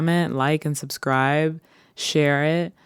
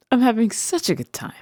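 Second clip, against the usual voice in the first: higher, faster burst of joy; quick, higher excited repeat; 8 semitones higher, faster than usual. Acted. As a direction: gush it out.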